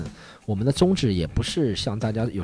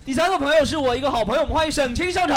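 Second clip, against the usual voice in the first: announcer call; faster than usual. Real, not acted. Introducing a guest on stage.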